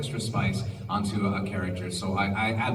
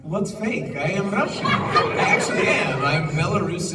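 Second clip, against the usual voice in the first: playful fake Russian accent, higher; 6 semitones higher, 3 dB louder than usual. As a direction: insist you're Russian in a thick accent. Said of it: "fake the Russian accent".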